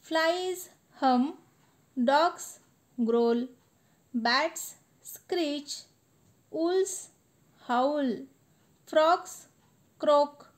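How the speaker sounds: poem recitation. Acted. Teacher reciting the final stanza to students, sing-song. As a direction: chant the last stanza with energy.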